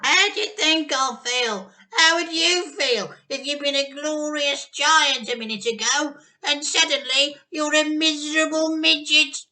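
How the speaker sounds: high, loud grandma rant; furious yell. Acted. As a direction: rant it in a shrill old voice. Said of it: "she yelled".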